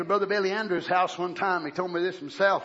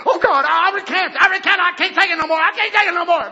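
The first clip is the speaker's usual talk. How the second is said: mock panicked plea; 9 semitones higher, 10 dB louder than usual. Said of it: "I said".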